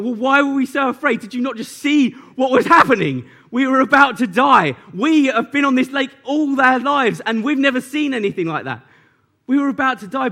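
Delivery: indignant disciples protesting. Acted. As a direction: push back hard, as if he missed the obvious.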